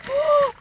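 sharp, high gasp of surprise; surprised gasp. Real, not acted. Vlogger unboxing a gift from a viewer, surprised gasp. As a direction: gasp at an unexpected present.

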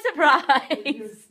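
usual speaking voice

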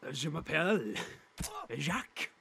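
put-on comic French voice; funny French accent. Friend trying the French funny voice, French accent. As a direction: copy a hammy French accent. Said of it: "do the funny voice".

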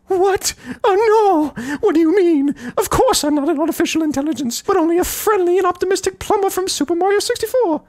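very high cartoon Mario, alarmed; squeaky Mario voice. Mario's shocked reply.

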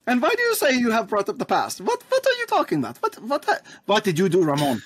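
high, rising indignant questions; suspicious questioning. Acted. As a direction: demand answers, pitch climbing. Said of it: "slowly look back and be like".

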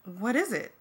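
ordinary delivery, nothing marked

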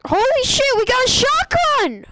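high, loud shout of joy; thrilled shout at a lucky find; excited shout. Real, not acted. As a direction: yell it like a jackpot win. Finds a shotgun in game.

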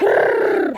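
rolled kr-r-r call, breathy and rising; chicken call. Real, not acted. Farmer calling her chickens with a rolled trill.

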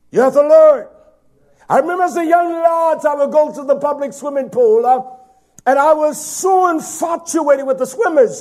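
loud, high, ringing sermon voice; full-force preaching. Acted. Preacher exhorting the congregation, at full force. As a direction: preach it to the back pews.

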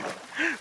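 sharp high gasp of fright; startled gasp. Real, not acted. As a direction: gasp as something lunges at you. Drowned rises from the water.

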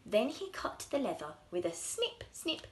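ordinary delivery, nothing marked

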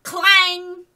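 high, ringing imitation of metal; loud clang. A narrator's reading. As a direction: shout the clang of a bat on a post. Sound of bat hitting lamppost.

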